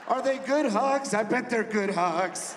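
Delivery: loud, slow, mocking disbelief; incredulous disbelief. Father learns grandpa hugs son, loud disbelief. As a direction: drag out the scorn, loud. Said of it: "I was like".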